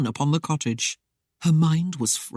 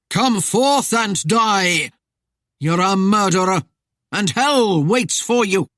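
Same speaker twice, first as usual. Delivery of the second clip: slow, loud spectral summons; eerie droning call; 4 semitones higher, 5 dB louder than usual. A narrator's reading. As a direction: drone it out long and raised, like Death calling. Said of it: "the droning voice called".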